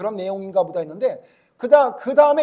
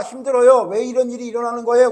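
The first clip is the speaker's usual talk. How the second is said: complaining loudly; 3 semitones higher, 7 dB louder than usual. Acted. Jeremiah's complaint voiced again.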